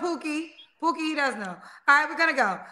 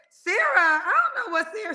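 ordinary talk

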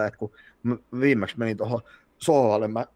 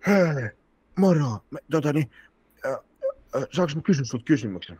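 imitating drunk woman; 5 semitones higher than usual. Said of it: "Tulee sieltä silleen".

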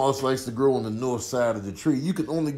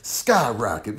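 ordinary delivery

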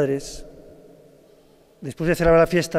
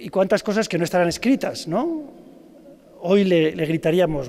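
ordinary talk, nothing marked

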